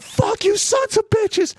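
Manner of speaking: high-pitched enraged yell; retold yelling. First sergeant cursing the chow-line workers, high furious yelling. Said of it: "starts yelling like that".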